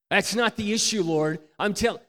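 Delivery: loud, high quick objection; protesting back. Retold or imitated. Husband interrupting God to protest, loud and high. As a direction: argue back defensively. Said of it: "God's rebuke".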